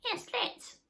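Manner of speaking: very high, eager little squeak; Sniff voice. A narrator's reading. Sniff eagerly agreeing to climb, very high squeak. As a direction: jump at the idea in a squeaky voice. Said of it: "said Sniff".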